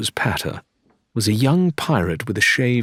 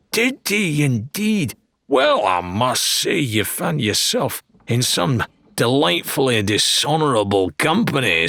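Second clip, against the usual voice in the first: higher, delighted salesman voice; beaming showman dealer; 6 semitones higher than usual. Acted. Wilbur beaming at Lorena, delighted showman voice. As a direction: gush over the mutual friend.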